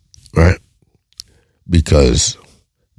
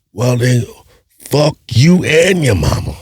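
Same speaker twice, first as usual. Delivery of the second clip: higher-pitched, exasperated retort; fed-up woman snapping; 9 semitones higher than usual. Retold or imitated. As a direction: snap it back at him, higher and fed up. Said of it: "the words are gonna come out of her mouth".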